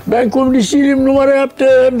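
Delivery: hammed-up backpedal, half laughing; retold panicked shout. Çetin taking it back loudly after being watched, mock laughing yell. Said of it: "diye bağırdı".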